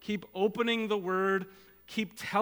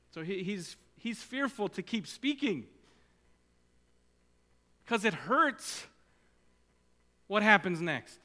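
usual speaking voice

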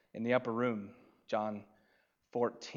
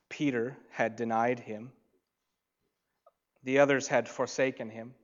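ordinary talk

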